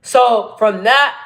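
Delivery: usual speaking voice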